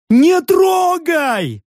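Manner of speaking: high, drawn-out desperate cry; pleading yell. A narrator's reading. Prism begs orc not to touch the bear, pleading yell. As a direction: cry out high, stretching the word.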